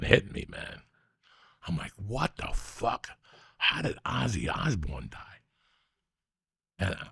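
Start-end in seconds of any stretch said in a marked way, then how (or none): 2.0-5.2 soft, slow retold shock; stunned disbelief. Recalling the drive after the news, hushed disbelief. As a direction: relive the shock quietly, stretching the words. Retells shock at death, alone driving.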